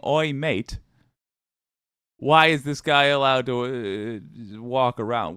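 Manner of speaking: lightly put-on British "oi, mate" voice; put-on British accent. Foreigners looking at us, puzzled, mock British accent. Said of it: "they're looking at us and they're saying".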